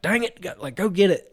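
a hint of impatient snapping; aggravated. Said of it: "kinda getting aggravated".

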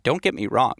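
ordinary talk, nothing marked

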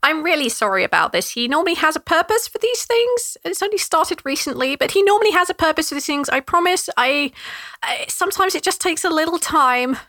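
sorry, embarrassed little brother; apologetic gnome Trevor. Acted.

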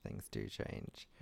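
slow, breathy whisper of the phrase. Pretend. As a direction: whisper it slowly, almost to yourself. Repeats the phrase, then whispers it.

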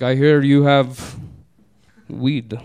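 put-on slow, halting Eastern European accent. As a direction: imitate a tall Eastern European, pausing between words. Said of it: "almost seven foot tall Eastern European man".

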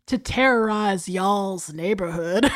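slow spooky voice through a laugh; a light creepy horror-host read; Vincent Price voice. Retold or imitated. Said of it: "got Vincent Price to say".

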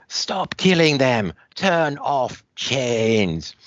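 put-on high, slow alien girl pleading; Dommy alien girl. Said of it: "then she goes".

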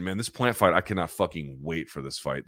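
ordinary talk

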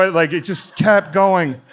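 ordinary delivery

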